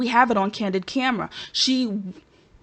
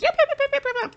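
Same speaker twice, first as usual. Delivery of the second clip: high-pitched rapid yapping; squeaky chattering pile-on of yeps; yapping chorus imitation; much faster than usual. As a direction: mimic girls yapping along in a high voice.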